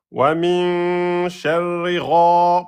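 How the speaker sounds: slow level chant, long n in "min", long "gha"; held prolongation. Shown as a demonstration. Teacher showing prolongation, "gha" chanted long. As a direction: hold "min" and "gha" on one note. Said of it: "Prolongation de temps".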